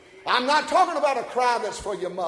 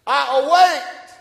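slow, high shout on the verse; declaimed scripture; 7 semitones higher, 11 dB louder, slower than usual. Real, not acted. Preacher reading a psalm to the congregation, proclaiming it in a slow shout. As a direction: ring it out loud and drawn out.

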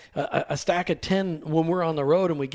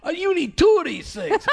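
hammed-up retelling; 9 semitones higher than usual. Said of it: "And you say".

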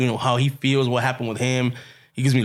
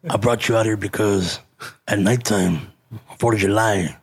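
imitating Olmos; 6 semitones lower than usual. Retelling Olmos's words.

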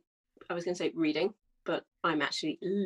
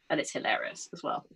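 ordinary talk

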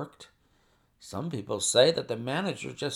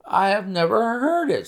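high, louder protest of surprise; taken aback; 10 semitones higher, 5 dB louder than usual. A narrator's reading. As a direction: answer as if the news stuns you. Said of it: "This took my breath away".

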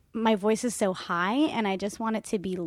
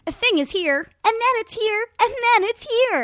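each phrase stepping higher in pitch; rising pitch steps; 12 semitones higher, 5 dB louder than usual. Shown as a demonstration. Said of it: "do a thing with my hands to, like, show".

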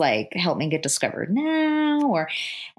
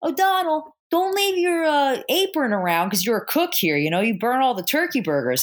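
much higher, put-on voice of the boss; bossy boss voice; 7 semitones higher than usual. Boss calls out the cook in front of the girl, high put-on voice. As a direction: squawk at him like a pushy boss. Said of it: "his boss ruins his cover 'cause he's like".

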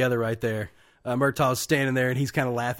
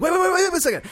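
mock frantic plea; 4 dB louder, much faster than usual. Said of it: "he's like".

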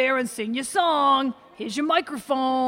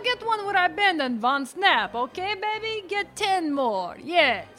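gushing glamour pose; 6 semitones higher than usual. Acted. Posing flirtatiously for a photo.

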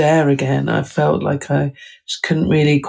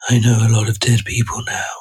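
lower, fast voice of the dead; Missus Death voice; 4 semitones lower, faster than usual. Retold or imitated. Author recalls the voice of Missus Death, lower and fast. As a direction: speak as Death who has met many dead. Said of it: "and I heard this voice".

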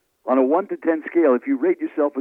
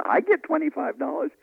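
much higher, incredulous question; amazed disbelief; 13 semitones higher than usual. Retold or imitated. Young speaker hears he gets paid, amazed. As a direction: squeak it out in happy disbelief.

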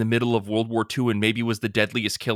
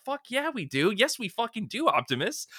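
fired-up fan; 9 semitones higher, 4 dB quieter than usual. Retold or imitated. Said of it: "And I'm like".

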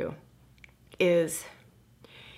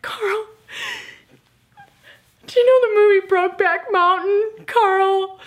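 high, loud crying plea; wailing; 8 dB louder than usual. Retold or imitated. Crying to his chiropractor on the table, high wailing. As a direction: sob the question out, much higher and louder. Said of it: "I am wailing".

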